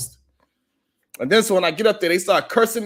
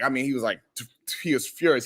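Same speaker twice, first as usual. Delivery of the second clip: ordinary talk, nothing marked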